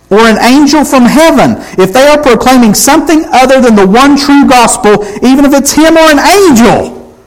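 high, emphatic pulpit intensity; full-force preaching. Acted. Preacher presses Paul's curse on false gospels, full force.

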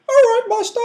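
very high, loud comic voice; Ray Park imitation. Imagining Ray Park answering Sidious, absurdly high comic voice. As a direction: squeak it out as a ridiculous henchman. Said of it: "Ray Park go".

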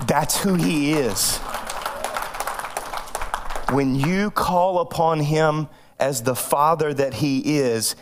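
low, slow, solemn preaching; sermon point. Acted. Pastor drives home the lesson to the congregation, slow and solemn. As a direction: let each phrase land with long pauses.

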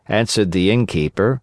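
usual speaking voice

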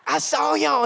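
put-on twang it like a Southern girl; country accent. Girl insisting she saw Peter, country accent. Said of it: "she has a country accent".

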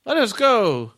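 high loud robot chat. Acted. Second robot chats.

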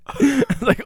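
usual speaking voice

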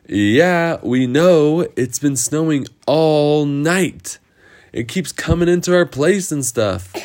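high-pitched weary complaint; exasperated Lost Boys.

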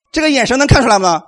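ordinary delivery

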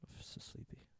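whispered, drowsy and soft; sleepy voice. Retold or imitated. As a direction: breathe it out half asleep. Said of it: "his face is always just like".

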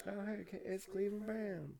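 soft, high, slowed cartoon impression; Cleveland Brown. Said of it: "I can't talk like him".

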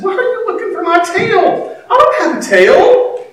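high-pitched puzzled mom; baffled mother. Retold or imitated. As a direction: imitate a mother stopped short in bewilderment. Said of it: "Mom stopped. She said".

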